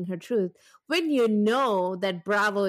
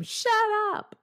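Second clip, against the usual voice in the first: mock shout; 12 semitones higher than usual. Host retells shouting back at her husband, mock yell. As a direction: playfully retell shouting someone down. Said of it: "I shouted back at him".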